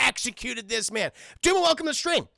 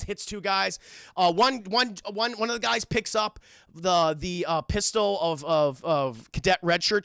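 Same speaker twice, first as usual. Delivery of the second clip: usual speaking voice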